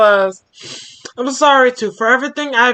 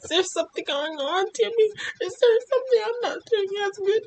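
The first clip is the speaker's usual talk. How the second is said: soft, high, whiny plea; a hint of a parent begging the child; mock pleading parent; 7 semitones higher, 9 dB quieter, faster than usual. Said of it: "As parents asking these questions".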